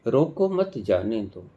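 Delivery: रोको मत जाने दो said straight through as one phrase; no pauses. Shown as a demonstration. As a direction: run रोको मत जाने दो together without any break. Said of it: "ऐसे बोला जाएगा तो इसको कोई अर्थ नहीं".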